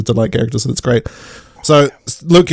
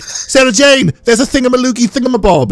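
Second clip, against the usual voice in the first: put-on high, urgent silly kid voice; mock excited boys; 10 semitones higher than usual. Boys alerting Sarah Jane to something, high excited mock voice. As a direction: call out in exaggerated boyish alarm.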